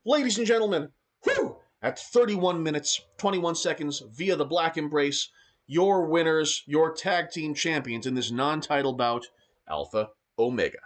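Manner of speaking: high, loud ringside commentary call; breathless wrestling announcer hype; excited play-by-play. Acted. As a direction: call the match like the crowd is on its feet.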